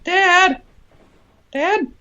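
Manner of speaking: put-on squeaky high child calling for dad; high-pitched child voice asking dad; whiny little kid. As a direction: play a needy little child begging dad for answers.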